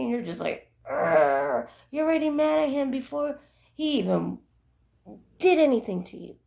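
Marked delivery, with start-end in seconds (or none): 0.9-1.7 loud fed-up argh; annoyed groan. Retold or imitated. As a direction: groan at him before he says anything. Wife's reaction voiced in story.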